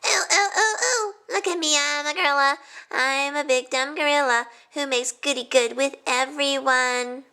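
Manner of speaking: high, hooting ape mockery; mock gorilla. Todd mocking the club as gorilla. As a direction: make fun of them with monkey hoots. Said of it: "grunting and scratching his armpits".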